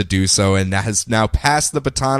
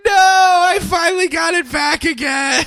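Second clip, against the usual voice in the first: much higher, whining protest; whiny Scarecrow. Retold or imitated. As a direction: wail like a thwarted villain. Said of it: "who's just like".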